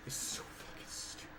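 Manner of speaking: soft whispered swearing; whispered curse. Real, not acted. Gamer whispering disgust at the controls. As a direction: mutter it under your breath.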